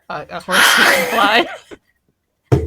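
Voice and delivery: playful slow suggestion. Real, not acted.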